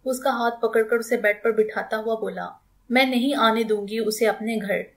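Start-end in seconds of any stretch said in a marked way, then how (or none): none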